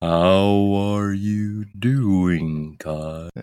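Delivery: very slow, dragged-out and low; slow motion. Shown as a demonstration. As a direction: stretch every word like slowed-down tape. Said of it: "are you talking in slow motion?".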